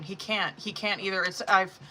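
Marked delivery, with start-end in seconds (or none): none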